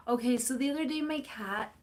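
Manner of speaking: usual speaking voice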